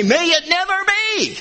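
"May it never be!" pitched high throughout; high, insistent exclamation on "May it never be!"; emphatic negation. Shown as a demonstration. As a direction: cry out "May it never be!" as an absolute refusal. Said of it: "it's so emphatic".

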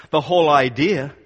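ordinary talk, nothing marked

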